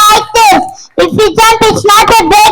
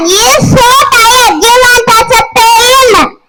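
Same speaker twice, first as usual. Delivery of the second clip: usual speaking voice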